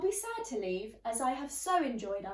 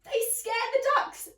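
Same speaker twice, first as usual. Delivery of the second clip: higher, louder indignant complaint; a fussy neighbour outraged about pirates; 10 semitones higher, 5 dB louder than usual. Acted. Fussy neighbour complains about the pirates, indignant and raised.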